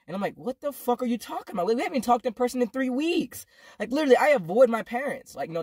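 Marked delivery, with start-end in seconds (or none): 0.4-3.5 high-pitched, incredulous retort; indignant protest. Retold or imitated. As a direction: retell your protest in disbelief. Retold indignant reply to stepdad.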